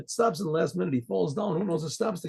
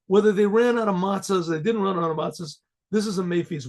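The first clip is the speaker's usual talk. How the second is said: ordinary talk, nothing marked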